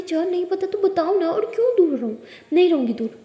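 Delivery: heated, stubborn insistence; insistent protest. Acted. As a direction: argue back and refuse to budge. Insisting during lovers' quarrel.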